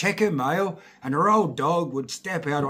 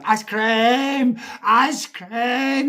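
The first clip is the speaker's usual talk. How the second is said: high, loud, gleeful holler; Eddie Murphy; 9 semitones higher, 9 dB louder, much slower than usual. Retold or imitated. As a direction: yell it like a kid chasing the van. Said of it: "It was like Eddie Murphy".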